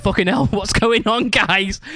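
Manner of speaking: mock alarm. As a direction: act out a bystander walking into a row. Venue worker's startled reaction, acted out.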